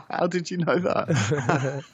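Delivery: slow, laughing disbelief. Real, not acted. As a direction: laugh through the question. Caught making tea on air.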